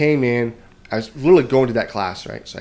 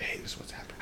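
hushed classroom whisper; voiceless classmates whispering; 19 dB quieter, much faster than usual. Retold or imitated. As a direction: breathe it like gossip in class.

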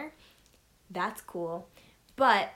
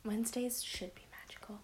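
hushed whispered echo; whispering; 8 dB quieter than usual. Real, not acted. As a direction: breathe it back as a secret. Echoes in a whisper.

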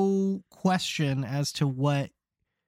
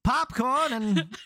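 stadium vendor hawking; 5 semitones higher, slower than usual. Pretend. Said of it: "vendor calls".

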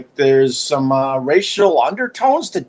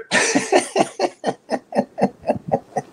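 embarrassed laugh; 3 dB quieter than usual. Real, not acted. Laughing at his own joke.

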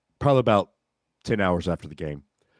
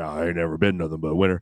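low harsh voice; 4 semitones lower, faster than usual. Retold or imitated. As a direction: rumble it low and fast like the Bear. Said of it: "in his deep, gravelly voice".

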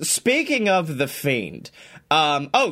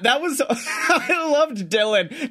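normal talking voice

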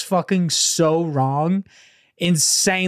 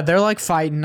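ordinary talk

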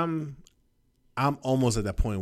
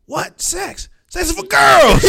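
much higher, louder mock outrage; hammed-up shocked father; squealing mock dad, louder at the end; mock outraged dad; 11 dB louder, slower than usual. Imitating shocked dad reaction.

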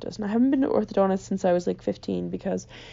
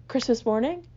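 normal talking voice

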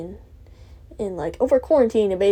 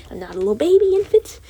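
higher, louder indignant protest; talking back to the imagined therapy lady, louder, mock indignant; indignant mock retort; 7 semitones higher, 6 dB louder than usual. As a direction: bristle at being babied. Retort to condescending voice.

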